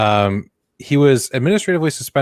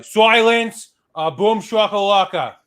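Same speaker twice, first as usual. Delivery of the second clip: put-on Boston accent; 8 semitones higher, slower than usual. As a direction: shout it Boston-style, slowly. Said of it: "You gotta do it with a Boston accent".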